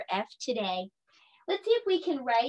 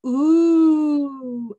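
loud rising ooh of delight; 4 semitones higher, 7 dB louder than usual. Shown as a demonstration. Teacher showing kids the circus food sound, a loud delighted ooh. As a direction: ooh like you can't wait to taste it. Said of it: "you can make this sound".

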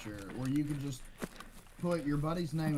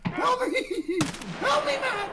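high, loud laughing panic; panicked pleading; 10 semitones higher, 6 dB louder than usual. Real, not acted. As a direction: beg for help, half laughing. Wounded teammate pleading, laughing.

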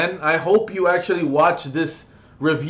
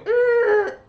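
high-pitched drawn-out "eee" noise; imitated descending whine. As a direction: mimic a plane's whine. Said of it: "it's been going".

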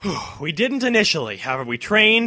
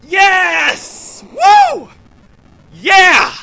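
huge triumphant shouts, much higher and louder; victory shout; 11 dB louder, much slower than usual. Real, not acted. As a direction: scream with joy after beating the boss. Winning the boss fight.

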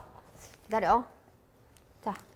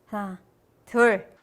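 ordinary delivery

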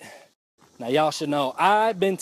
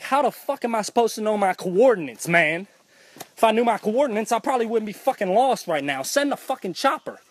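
higher and rising, a mock furious rant; exasperated rant; 7 semitones higher than usual. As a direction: build your outrage toward the last line. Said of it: "I'm like".

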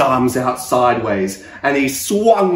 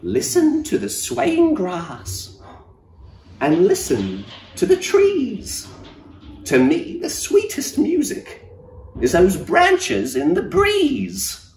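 high, gentle cricket voice; little cricket voice; 12 semitones higher, slower than usual. Acted. Cricket advising the sad giraffe in a high gentle voice. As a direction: lift it up into a tiny kind voice.

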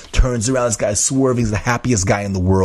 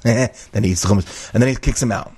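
normal talking voice

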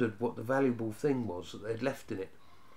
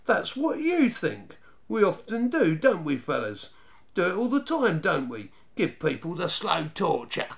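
high, emphatic jeer, dropping on the tags; raised, mocking swagger; taunting boast; 5 semitones higher, 5 dB louder than usual. A narrator's reading. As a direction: menace him while rallying the gang. Gang leader taunts the boy.

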